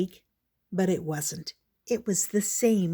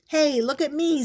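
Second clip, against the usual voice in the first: high, excited squeal; 7 semitones higher, 3 dB louder than usual. Acted. Squeak squealing to Pip while sliding around, high. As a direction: show off with glee. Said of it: "Squeak squealed".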